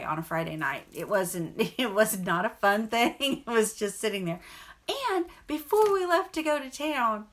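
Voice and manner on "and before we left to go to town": ordinary talk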